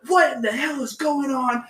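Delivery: high-pitched mock outrage, not shouted; imitating mad teacher. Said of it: "and she's mad".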